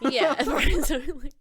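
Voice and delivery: laughing through the words. Real, not acted. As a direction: agree while laughing. Laughing while agreeing.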